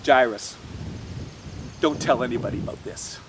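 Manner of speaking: low, hushed instruction; confidential. A narrator's reading. Jesus asking Jairus to tell nobody, confidential. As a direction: keep it low, between the two of you.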